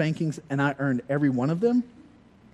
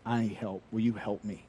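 softer, lower apologetic request; sorry plea; 5 semitones lower, 8 dB quieter than usual. Retold or imitated. Calling Rob for help at night, softer and apologetic. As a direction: ask for help, sheepish and soft. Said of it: "I said".

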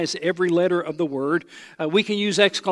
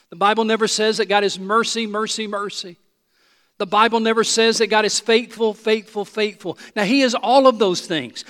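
normal talking voice

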